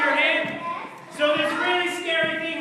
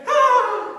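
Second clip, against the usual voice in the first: high loud hammed-up shriek; mock scream; 10 semitones higher, 9 dB louder than usual. King hearing his fate, loud mock shriek.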